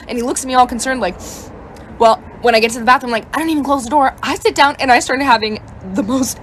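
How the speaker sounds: ordinary talk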